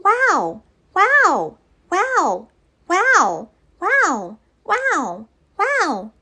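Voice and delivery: high squeaky little creatures; tiny puff voices. Acted. Puffs greeting Betty with high squeaky wows. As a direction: chirp wow like tiny fluffy puffs.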